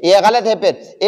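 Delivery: usual speaking voice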